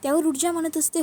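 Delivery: ordinary delivery, nothing marked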